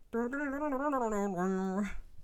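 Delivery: sung-out banjo noodling; mouth-made banjo twang; imitated banjo riff. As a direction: rattle off a banjo lick with the mouth.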